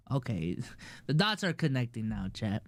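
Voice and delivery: soft, fast words through a real chuckle; laughing realisation. Real, not acted. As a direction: laugh as the pieces click into place. Realisation about Jake Paul link.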